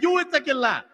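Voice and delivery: high-pitched mock comeback; indignant retort. Customer snaps back at the guard, mock-indignant. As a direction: throw it right back, offended.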